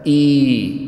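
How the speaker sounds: إي drawn out as a sustained "ee"; long madd vowel. Shown as a demonstration. Teacher demonstrating the ya of madd, long held "ee". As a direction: hold إي long as a pure "ii". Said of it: "وعندنا الياء أيضًا المدية ساكنة".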